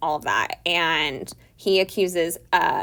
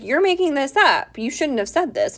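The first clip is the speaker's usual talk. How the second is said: angry accusation; 7 semitones higher, 4 dB louder than usual. Retold or imitated. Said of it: "keeps getting mad at him".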